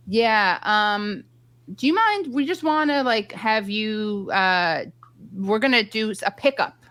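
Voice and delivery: tentative, stop-and-start imitated ask; hesitant request, imitated. Imitating hesitant show staff's request.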